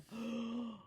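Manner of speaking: acted horrified gasp; shocked gasp. Reader plays Sizzle seeing the kitten, shocked gasp. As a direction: gasp sharply in mock shock. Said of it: "what did Sizzle say?".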